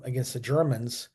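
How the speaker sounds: ordinary talk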